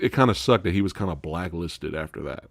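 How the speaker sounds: ordinary talk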